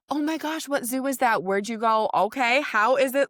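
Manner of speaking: high-pitched, enthusiastic questions; gushing, chatty curiosity; imitated eager interest. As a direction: play a doctor delighted to ask about it. Voicing her friendly, interested doctor.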